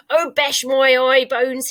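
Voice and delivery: high, slow, loud giant's cry; distressed cry. A narrator's reading. Distressed giant crying over the jar, high and slow. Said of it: "he cried".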